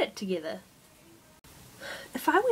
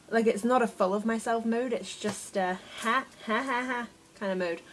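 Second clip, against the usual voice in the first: ordinary talk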